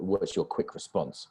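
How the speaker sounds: usual speaking voice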